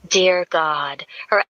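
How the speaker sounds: ordinary delivery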